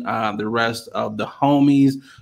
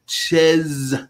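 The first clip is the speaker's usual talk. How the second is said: put-on drawn-out, clumsy Borat accent; bad Borat accent. Retelling a failed food order in Paris, bad Borat accent. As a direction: do the worst Borat accent on one word. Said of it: "the worst Borat accent".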